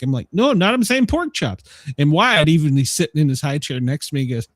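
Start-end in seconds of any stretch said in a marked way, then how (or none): 0.3-1.6 higher-pitched, insistent denial; retold exasperated protest. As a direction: replay your indignant protest to the family. Retold protest to family at dinner.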